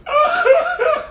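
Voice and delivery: high-pitched cackle; high burst of laughter. Real, not acted. Big laugh.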